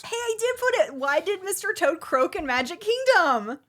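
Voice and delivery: high, loud punchline delivery; jokey heckle. Acted. Cracking a joke, demanding applause.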